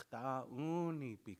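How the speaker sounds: "Chtaunipi" stretched very slowly syllable by syllable; slow drawn-out Dakota word. Shown as a demonstration. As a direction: say "Chtaunipi" slowly to hear yourself. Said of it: "you could hear yourself say it better".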